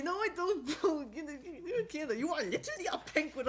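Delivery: high mocking voice, bro-ish; quoting the animatic. Retelling the animatic banter in a high mocking voice. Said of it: "one of them said that".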